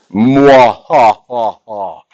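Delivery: hammy villain laugh mwah ha ha; evil laugh. Pretend. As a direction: cackle like a cartoon villain. Teacher's mock laugh.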